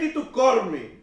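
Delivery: loud, high mock outburst; irate caller. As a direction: imitate an angry caller snapping back loudly. Retold angry caller before hanging up.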